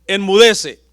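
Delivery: loud sharp order to the sea; booming rebuke of the waves; loud command. Acted. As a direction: command the storm to be silent. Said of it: "como cuando le dijo al mar".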